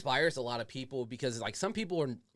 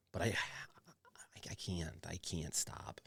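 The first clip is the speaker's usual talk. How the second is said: whispering; 5 semitones lower, 9 dB quieter, much slower than usual. Real, not acted. Confessing addiction, drops to whisper.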